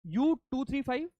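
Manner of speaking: slow, high, spelled out for writing; dictating. Shown as a demonstration. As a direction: read it out slowly for notes. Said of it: "let me dictate now".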